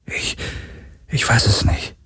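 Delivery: stockend hingehaucht, kaum Stimme; geflüstert. Acted. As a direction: leise gestammelt, damit keiner es hört. Flüsternde Antwort in Todesgefahr. (zögerndes Flüstern voller Zweifel)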